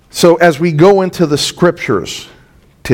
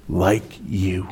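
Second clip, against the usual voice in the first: a sly whispered jab, breath only; whispered aside; 9 semitones lower, 11 dB quieter than usual. Retold or imitated. Preacher voicing the unspoken insult to Jesus, whispered. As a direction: drop to a hush as if the words went unsaid.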